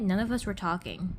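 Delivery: usual speaking voice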